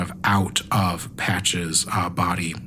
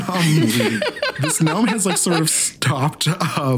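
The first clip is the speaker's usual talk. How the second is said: laughing; 7 semitones higher, slower than usual. Real, not acted. GM laughs while narrating.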